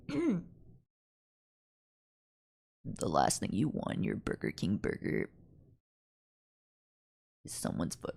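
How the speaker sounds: imitating Chills with heavy vocal fry, very low; Chills impression. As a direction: croak it out low like a narrator with fry. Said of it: "Chills is like".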